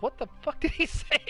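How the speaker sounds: high, laughing disbelief; shocked Steve Harvey. Retold or imitated. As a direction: replay his stunned outburst, laughing. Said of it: "he's just like".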